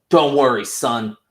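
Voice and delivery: high, loud, slow fatherly superhero voice; hammed-up smug hero reassuring his son; loud put-on hero voice, dragging the words; mock Homelander. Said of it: "Homelander shows up and is like".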